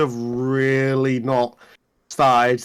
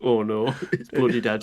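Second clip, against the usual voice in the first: put-on Yorkshire accent; 3 semitones lower than usual. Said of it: "Yorkshire accents for everyone".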